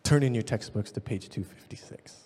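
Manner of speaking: unvoiced whisper. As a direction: drop to a hush after the shout. Quiet after the shouting.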